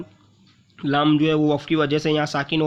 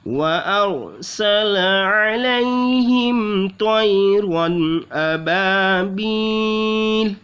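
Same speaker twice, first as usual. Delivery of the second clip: slow, drawn-out tajweed recitation; slow melodic Quran recitation; Quranic recitation; 4 semitones higher, much slower than usual. Acted. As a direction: chant the verse melodically. Recites the verse.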